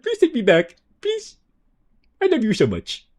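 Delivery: high whimpering plea; sad dog voice. Pretend. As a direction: beg like a sad puppy. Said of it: "they just turned into a sad dog".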